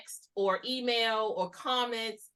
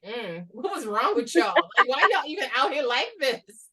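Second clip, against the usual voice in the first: mock indignation; 3 semitones higher, 5 dB louder than usual. Voicing youngsters judging older people's outbursts.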